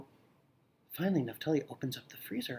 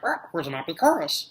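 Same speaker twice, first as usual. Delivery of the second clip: parrot voice; 4 semitones higher, 6 dB louder than usual. Acted. Parrot insults.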